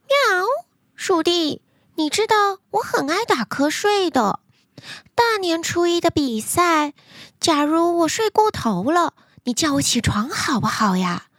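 high, sweet pleading cat. Acted. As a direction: meow and ask sweetly. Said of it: "它对老鼠弟弟说".

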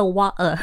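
"water" with the T swallowed; dropped T. Shown as a demonstration. Teacher catching her own slip, glottal stop in "water". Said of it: "我刚刚说了water".

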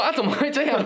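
high, laughing disbelief; shocked squeal. Retold or imitated. Sister gasping at her brother's news, laughing high squeal.

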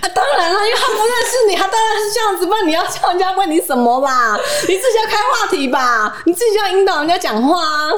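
high-pitched scolding with laughter breaking in; fed up, half-laughing rant; sudden exasperation. Real, not acted. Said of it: "我突然间生气".

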